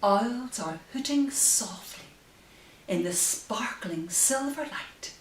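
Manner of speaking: gentle sing-song rhyme reading; reading to children. Acted. Bedtime reading the rhyme aloud to her baby. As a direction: read the rhyme like a lullaby.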